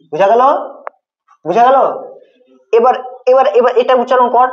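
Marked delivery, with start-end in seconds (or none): none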